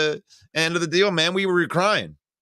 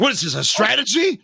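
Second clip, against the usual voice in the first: raised, incredulous question; baffled disbelief; 5 semitones higher than usual. Retold or imitated. Fan reenacting confusion at the kneel-down, baffled. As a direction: imitate a fan who cannot grasp it.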